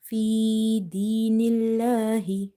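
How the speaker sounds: "الله" with a light, thin l and long vowel; light lam. Shown as a demonstration. Teacher showing the lam is light, light-l recitation. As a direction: recite "الله" keeping the l light, never heavy. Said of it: "Lam is light".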